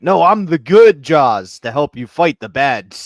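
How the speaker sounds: loud, slow, triumphant declaration; mock superhero entrance, booming; heroic announcement. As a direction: proclaim it like a rescuing hero. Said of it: "Caitlyn Jenner showed up and was like".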